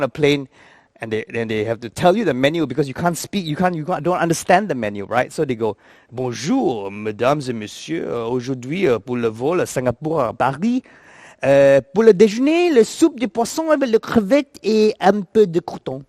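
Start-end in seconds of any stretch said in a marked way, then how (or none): 6.1-16.0 sexy French. Retold or imitated. French attendant telling passengers the menu, seductive French accent. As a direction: purr the menu in flowing French. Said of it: "French is so sexy".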